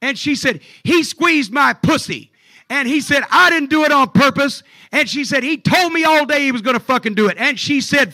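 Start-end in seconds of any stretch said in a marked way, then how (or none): 0.8-2.3 slow, loud, high-pitched accusation; angry wife. Retold or imitated. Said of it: "she's hot". 3.3-4.6 loud, high mock shout back; indignant denial. Retold quarrel, wrestler shouts back. 5.4-7.4 high, loud retold accusation; angry wife. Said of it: "she's hot".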